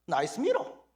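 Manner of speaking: put-on slow, high, foreign-accented English; foreigner's English. Foreign customer admiring the mirror in accented English. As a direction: drawl the English like a visitor. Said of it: "이 외국 사람이 뭐라 그랬겠어요".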